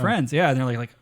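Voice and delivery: ordinary talk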